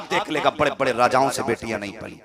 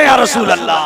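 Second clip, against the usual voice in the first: loud, higher call to the crowd; shouted call; 6 semitones higher, 9 dB louder than usual. Shown as a demonstration. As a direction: cry it out for the crowd. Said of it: "जरा कह दे". (श्रोताओं से ज़ोर से पुकार)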